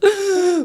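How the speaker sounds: loud high mock gasp of alarm; imitated shocked gasp. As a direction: gasp like he just got startled. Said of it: "He goes".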